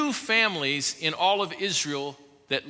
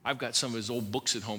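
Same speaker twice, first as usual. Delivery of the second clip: ordinary talk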